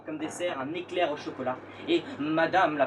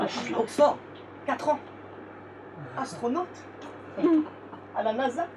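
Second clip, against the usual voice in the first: put-on higher, smug bragging kid; 9 semitones higher than usual. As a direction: brag like a posh kid. Posh schoolmates boast.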